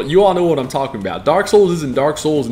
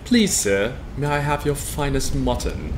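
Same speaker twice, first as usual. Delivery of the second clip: softer, pleading British request; meek British accent; 5 dB quieter than usual. Pretend. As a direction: beg politely like a British servant. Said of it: "I dig the Brit accent".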